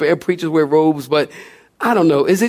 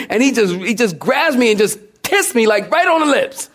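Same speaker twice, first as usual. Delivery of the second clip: usual speaking voice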